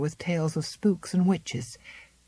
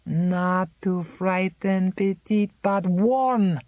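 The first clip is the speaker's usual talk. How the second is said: put-on gentle old warner; 2 semitones higher, 3 dB louder, slower than usual. Old Zélie gently correcting Miranda, slow and grave.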